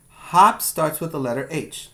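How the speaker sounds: ordinary talk, nothing marked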